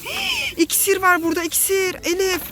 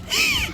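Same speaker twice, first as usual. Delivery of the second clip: very high quick pretend gasp; mock gasp; 4 dB quieter than usual. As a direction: gasp in play shock. Pretend discovery.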